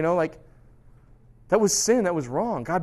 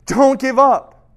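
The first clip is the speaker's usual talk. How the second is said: slow, louder, drawn-out plea; slow emphatic urging; 6 semitones higher, 8 dB louder, much slower than usual. Retold or imitated. Jesus urging John in prison, slow and pressing. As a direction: lean into every word.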